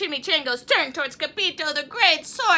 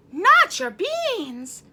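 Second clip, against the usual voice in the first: outraged; 6 semitones higher, 7 dB louder, much slower than usual. Acted.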